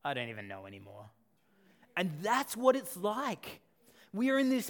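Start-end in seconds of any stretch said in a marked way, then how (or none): 0.0-1.1 low, fast sorry mumble; a light take of a defeated teen; mock sad resignation. Pastor retelling a Simpsons gag, lightly voicing a sad teen. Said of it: "sadly says".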